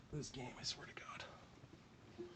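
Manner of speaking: exasperated whisper under the breath; fed-up whispered aside. Real, not acted. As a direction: hiss it to yourself in disbelief. Frustrated aside during game.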